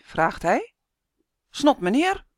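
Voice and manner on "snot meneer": higher cheeky call out. A narrator's reading. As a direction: call it again to provoke. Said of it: "roept Sander". (brutaal roepen)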